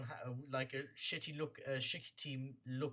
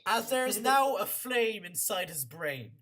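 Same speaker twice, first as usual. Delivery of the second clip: dramatic narration; 6 semitones higher, 5 dB louder than usual. A narrator's reading. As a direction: relish the gruesome detail. DM narrates flaming kill.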